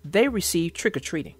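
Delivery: put-on gentle storyteller reading to kids; story narration. A narrator's reading. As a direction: read it like a bedtime picture book. Reads children's story aloud.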